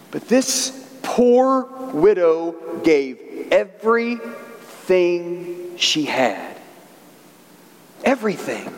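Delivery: each word drawn out, falling at the end; slow right down and let every word weigh; slow emphatic delivery. Real, not acted. Preacher driving home the widow's gift, slow and emphatic.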